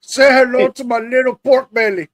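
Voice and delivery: Tony Montana impression. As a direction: boom it out like Scarface. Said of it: "image of Tony Montana".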